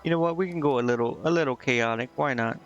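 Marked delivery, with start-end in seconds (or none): none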